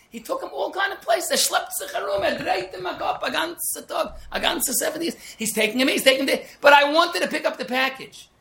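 lightly hammed-up lament; high mocking singsong. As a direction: tease the pointless trip around. Mocking the soul's wandering.

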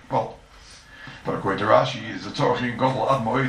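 ordinary delivery

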